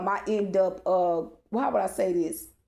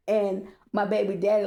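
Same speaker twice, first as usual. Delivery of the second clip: ordinary talk, nothing marked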